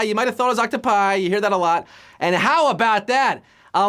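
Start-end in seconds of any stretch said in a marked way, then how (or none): none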